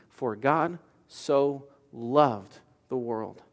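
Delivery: very slow, soft, drawn-out verse; slow reverent quote. Acted. Preacher quoting Jesus to congregation, slow and reverent. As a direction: savour each word slowly. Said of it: "most important word in that statement".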